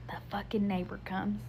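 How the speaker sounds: whispered aside, dreading her; whispered curse at the neighbor; exasperated whisper. Real, not acted. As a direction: hiss it under your breath.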